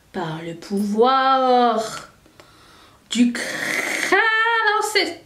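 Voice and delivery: slow, drawn-out mock-heroic invocation; incantation héroïque pour rire. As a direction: stretch it like a cartoon hero's power cry. (fausse incantation héroïque, lente et étirée)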